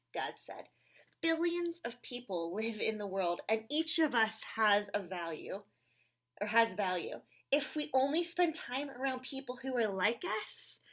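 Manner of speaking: lively read-aloud with character voices; reading to children. Acted. Reading a picture book aloud to children, animated. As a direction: read it brightly to the little ones.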